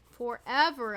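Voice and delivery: Forever drawn out and punched loud; stress on forever. Real, not acted. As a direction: hit Forever hard and falling. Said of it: "I tell you".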